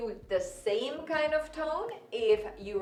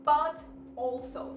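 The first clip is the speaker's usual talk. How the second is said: "but also" slow, with "but" punched; stressed but also; 3 semitones higher, 5 dB louder, slower than usual. Shown as a demonstration. Teacher stressing "but also" in the worksheet sentence. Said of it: "the second part here is".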